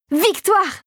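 high-pitched cheer of triumph; jubilant cry. A narrator's reading. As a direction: burst out joyfully, pitched well up. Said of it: "bondirent de joie les deux régents".

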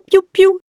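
very high, loud piou piou; high chirpy chick peeps; chick cheeping. A narrator's reading. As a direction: imitate the chick with glee. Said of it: "lui dit Jeanne en l'applaudissant".

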